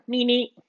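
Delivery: high two-beat horn honk; imitated car horn. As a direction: mimic a car horn beeping twice. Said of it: "We hear a horn".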